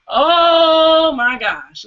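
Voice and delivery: long, high, loud drawn-out "oh"; exasperated. Retold or imitated. Woman reacting to being accused of attitude, drawn-out exasperation.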